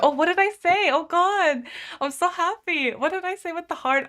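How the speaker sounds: high, bright excited squeal. Real, not acted. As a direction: burst out happily. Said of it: "I'm, like, really excited there".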